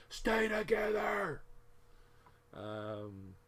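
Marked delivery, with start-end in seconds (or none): 0.1-1.4 shouting. Real, not acted. Shouts at the seam.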